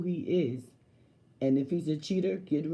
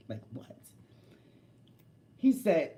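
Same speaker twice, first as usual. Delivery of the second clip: ordinary talk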